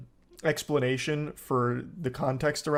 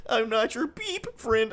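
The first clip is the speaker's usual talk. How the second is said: high, slow line with BEEP as a censor tone; mock self-censored reading; 6 semitones higher than usual. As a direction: bleep the swear before "friend". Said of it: "He's literally beeping himself with a sensor".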